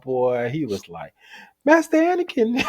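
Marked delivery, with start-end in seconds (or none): 1.6-2.6 very high mock child voice; little kid. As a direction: squeak it like a small boy. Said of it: "that kid came out".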